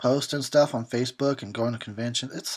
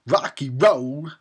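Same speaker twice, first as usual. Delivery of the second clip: slow, drawn-out Walken-ish delivery; Christopher Walken; 5 dB louder, much slower than usual. Real, not acted. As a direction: stretch each word like Walken. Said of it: "I sounded like Christopher Walken".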